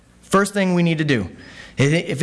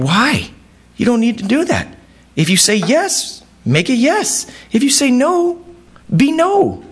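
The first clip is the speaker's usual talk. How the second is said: emphatic Jesus; 9 semitones higher than usual. Retold or imitated. Jesus voiced, rising emphasis.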